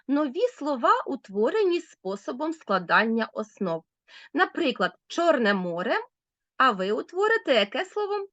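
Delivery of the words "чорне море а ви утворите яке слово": ordinary talk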